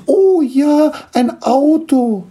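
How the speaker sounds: put-on very high, loud child gnome squeal; little Schlomi calling out. Youngest gnome hears of a toy car, delighted high cry. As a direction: call it out in delight, drawn out. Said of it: "rief der kleine Schlomi".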